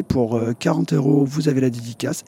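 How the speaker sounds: ordinary delivery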